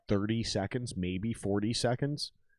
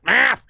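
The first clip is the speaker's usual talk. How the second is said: math! punched loud and higher; emphatic math; 13 semitones higher, 12 dB louder than usual. Real, not acted. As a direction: hit math! hard. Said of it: "understanding the actual math".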